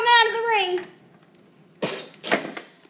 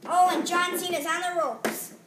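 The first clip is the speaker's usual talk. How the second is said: usual speaking voice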